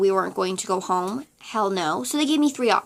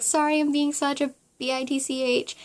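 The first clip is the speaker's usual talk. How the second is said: sheepish apology; 3 semitones higher, 3 dB quieter than usual. Retold or imitated. Apologizing to her mom.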